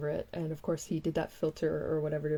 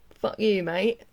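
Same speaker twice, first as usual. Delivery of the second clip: mock British; 3 semitones higher, slower than usual. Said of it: "the British way".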